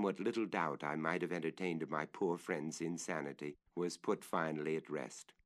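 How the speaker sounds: plain reading voice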